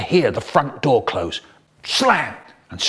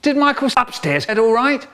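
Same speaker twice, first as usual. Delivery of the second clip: high, called-out question up the stairs; mum calling out; 10 semitones higher than usual. Retold or imitated. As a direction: call up from the hall. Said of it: "My mum calls out".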